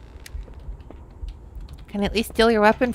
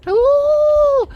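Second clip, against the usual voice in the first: surprised squeal; 6 dB louder than usual. Real, not acted. Sudden exclamation.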